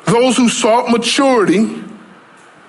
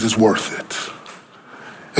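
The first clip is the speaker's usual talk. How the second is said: hushed, unvoiced whisper, much softer; 11 semitones lower, 8 dB quieter, much slower than usual. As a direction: breathe the promise out in a slow whisper. Peter's answer, whispered.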